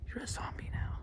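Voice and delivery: eerie unvoiced whisper. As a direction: breathe it like a ghost story. Spooky pretend zombie.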